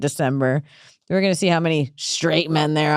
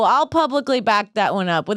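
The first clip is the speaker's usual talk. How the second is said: ordinary talk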